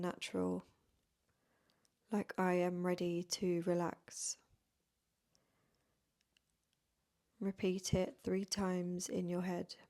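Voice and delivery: soft, unhurried yoga nidra voice with long pauses; guided meditation. Real, not acted. Yoga teacher leading beginners into rest before bed, guided meditation. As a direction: gently guide them into stillness, phrase by phrase.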